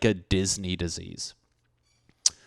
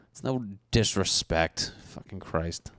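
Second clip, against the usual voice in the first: usual speaking voice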